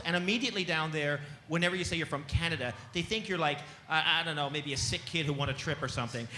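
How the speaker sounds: normal talking voice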